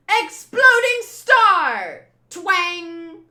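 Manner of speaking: high, loud, slow attack call; attack shout. Acted. Usopp firing his attack, a loud high battle yell. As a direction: roar the attack name like an anime hero.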